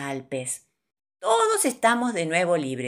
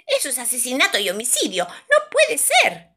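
mosquito's shrieked protest; 7 semitones higher, 4 dB louder than usual. A narrator's reading. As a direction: protest fast, then wail the last words high. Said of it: "grita el mosquito". (protesta chillona y aguda de mosquito)

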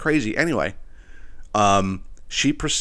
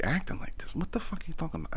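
whisper; 7 semitones higher, 11 dB quieter, faster than usual. Retold or imitated. Retold shocked reply.

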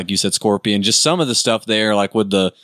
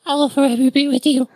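high ghostly voice. Retold or imitated. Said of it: "Shang's like".

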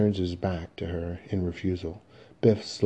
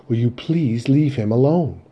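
loud, higher-pitched plea; suddenly pleading; 5 semitones higher, 8 dB louder than usual. Acted. Mother to son about his father, suddenly pleading. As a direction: beg him in a raised voice. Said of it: "Linda, suddenly pleading".

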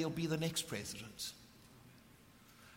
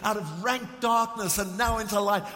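raised, ringing preacher's voice; full-force preaching; 4 semitones higher, 6 dB louder than usual. Acted. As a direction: preach it out passionately to the congregation. Preaching climax of the story.

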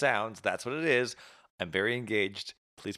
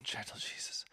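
whispered under breath; 13 dB quieter than usual. Real, not acted. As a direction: breathe it out unvoiced. Hiccup aside.

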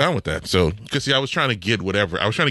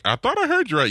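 high incredulous; faster than usual. Retold or imitated. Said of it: "I'm like".

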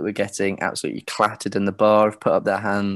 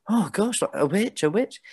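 worried crowd; 11 semitones higher than usual. Retold or imitated. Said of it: "some people start to go".

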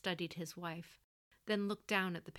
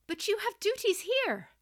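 put-on much higher, louder, pleading young voice; erupting protest; 9 dB louder than usual. Son protesting to his mother, high and loud. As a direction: blurt it out in alarm. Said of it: "Protests he could not stifle erupted".

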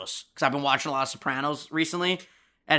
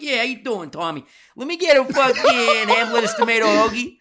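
higher, louder New York swagger; brash local customer; 6 semitones higher, 4 dB louder than usual. Acted. Customer walking into the deli to greet Tommy, brash and loud. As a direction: order boldly like a neighborhood regular. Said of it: "But if you walk in like".

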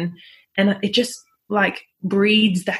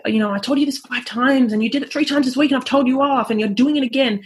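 mock frustrated parent; 5 semitones higher, faster than usual. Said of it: "they'll say".

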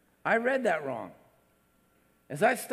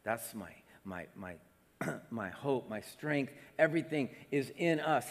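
softer, lower tender voice of Jesus; 8 semitones lower, 6 dB quieter than usual. Pretend. Preacher voicing Jesus's tender love for his child. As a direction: murmur with fond love. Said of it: "he smiles from ear to ear, just".

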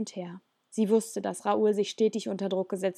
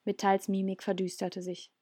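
ordinary delivery, nothing marked

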